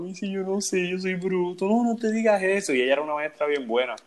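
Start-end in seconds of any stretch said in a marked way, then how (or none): 0.1-1.7 mock whining. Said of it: "él lloraba". 1.7-2.7 high, sing-song reassurance; mock soothing teacher. Teacher reassuring the crying student, high mock comfort.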